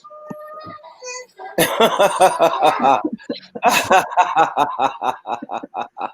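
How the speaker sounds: loud rolling laughter; a streamer laughing at his own bit; long hearty laugh. Real, not acted. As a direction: crack up for a long stretch. Long laugh after fail.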